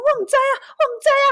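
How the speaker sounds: mother panicking to the neighbor; frantic mother. Retold or imitated. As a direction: cry it out in helpless panic. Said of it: "他说".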